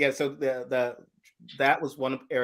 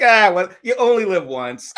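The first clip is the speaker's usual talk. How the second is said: higher, louder, laughing shrug; shrugging lion; 8 semitones higher, 9 dB louder than usual. Retold or imitated. Lion shrugging before eating the man, laughing. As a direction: toss it off with a laugh. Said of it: "he's like".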